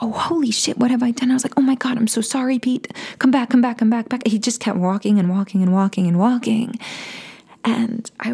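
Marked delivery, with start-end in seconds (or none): 0.0-1.3 higher, hushed panic at oneself; mild dismayed gasp of words; retold alarm. Acting out instant regret. 1.6-4.3 higher, hurried pleading; urgent replayed apology, not shouted; retold frantic apology. Pleading after friend walking away.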